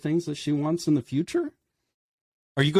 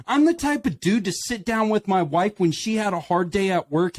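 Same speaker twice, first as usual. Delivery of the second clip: usual speaking voice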